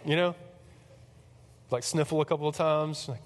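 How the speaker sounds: usual speaking voice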